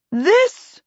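high, indignant princess voice; indignant outburst. A narrator's reading. Princess protesting at the chaos on the stairs, indignant. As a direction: pitch it high in royal outrage.

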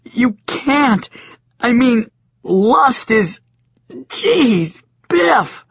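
high, loud stammering; high shaken stammer; stuttering distress. A narrator's reading. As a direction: stumble over the words in dismay. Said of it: "Joshua stuttered".

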